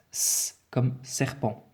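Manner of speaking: slow, drawn-out hissing S in "serpent"; "S comme serpent" with a long hissed S. Shown as a demonstration. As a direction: stretch the "S" of "serpent" into a long hiss. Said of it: "je vais devoir prononcer mon S".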